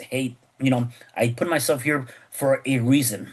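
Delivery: ordinary talk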